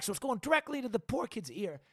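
ordinary talk, nothing marked

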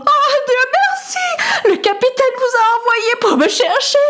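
a frightened lady gasping with relief; sobbing lady. A narrator's reading. Lady trapped in the storm greets her rescuer, high and tearful. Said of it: "elle était secouée de sanglots".

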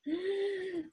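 quick, quiet sucking gasp of air; breathing-exercise gasp; sharp gasp. Shown as a demonstration. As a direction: gasp in to fill the chest. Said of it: "I also made a sound".

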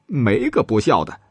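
plain reading voice

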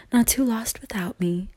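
whispered, playful aside; a soft playful whisper to the viewers; teasing whisper. Pretend. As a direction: whisper it as a cheeky joke. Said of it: "Just kidding".

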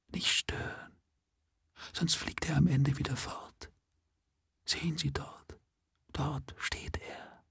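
soft, high unvoiced whisper; mysterious whisper. A narrator's reading. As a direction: whisper a feverish secret. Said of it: "flüsterte geheimnisvoll".

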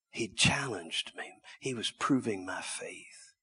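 normal talking voice

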